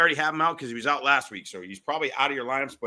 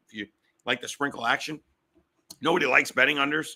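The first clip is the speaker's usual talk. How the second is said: ordinary delivery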